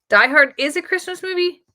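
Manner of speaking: surprised. Real, not acted. Doubting a commenter's pick, high incredulous question. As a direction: question it in real disbelief. Reacting again to Die Hard.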